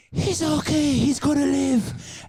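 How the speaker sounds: high, slow triumphant declaration; relieved announcement. Retold or imitated. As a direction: tell the crowd he lives, drawn out. Hero announces dog survives.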